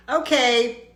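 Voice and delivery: ordinary talk, nothing marked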